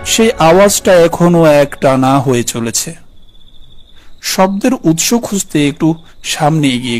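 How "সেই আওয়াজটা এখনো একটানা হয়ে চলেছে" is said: usual speaking voice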